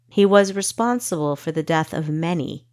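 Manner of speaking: plain reading voice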